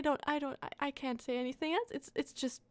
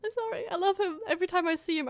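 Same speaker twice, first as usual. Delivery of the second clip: excited gushing; 6 semitones higher, 4 dB louder, much faster than usual. Real, not acted. Gushing over a favourite character.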